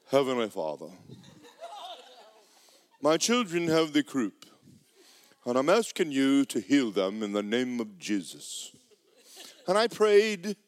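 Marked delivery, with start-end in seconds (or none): 0.1-8.7 low, soft timid prayer voice; lightly voiced half-hearted prayer; limp prayer. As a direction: pray like you expect nothing. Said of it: "like all the other people that I saw pray".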